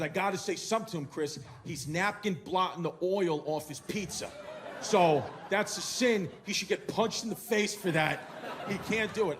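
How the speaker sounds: loud, fired-up rant, slowing on the sin line; mock furious father rant; indignant dad. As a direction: rant like outraged over pizza etiquette. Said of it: "He goes".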